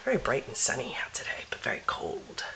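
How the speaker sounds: hushed unvoiced whisper. Real, not acted. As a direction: whisper it under your breath. Whispered aside on the deck.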